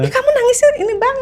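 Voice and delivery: very high, quick exclamation; imitated amazement. Stranger retold marvelling at her crying, imitated amazement. Acts stranger's surprised remark.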